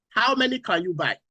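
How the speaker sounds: normal talking voice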